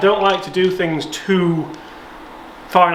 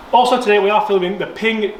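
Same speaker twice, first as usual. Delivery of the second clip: presenter voice; 6 semitones higher than usual. Acted. False start retake.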